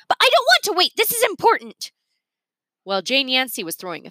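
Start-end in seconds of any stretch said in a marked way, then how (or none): 0.1-1.9 put-on much higher, louder child voice; a spoiled kid pleading with daddy; whiny little girl. A narrator's reading. Jane whining to her busy father, high and childish.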